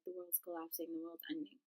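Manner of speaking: low, barely audible murmur; imitated quiet dread; doomed roommate. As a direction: murmur it like a hushed prophecy. Roommate's doom speech repeated.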